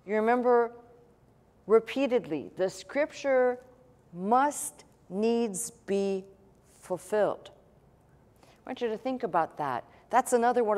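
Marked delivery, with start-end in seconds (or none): 2.6-7.5 very slow, weighted MUST NEEDS BE, word by word; stress on must. Shown as a demonstration. Preacher quoting scripture that must be fulfilled, slow and emphatic. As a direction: draw out "must" and set each following word apart. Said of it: "I'm emphasizing the word must".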